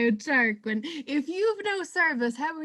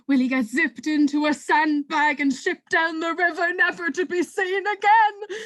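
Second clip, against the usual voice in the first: dramatic announcer; 3 semitones lower, 4 dB louder than usual. Acted. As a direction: hype the cliffhanger like a TV host. Host opens dramatic episode.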